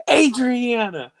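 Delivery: high, drawn-out reproachful name; mock scolding mom. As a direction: imitate a mother calling out her child. Retold mother scolding her daughter.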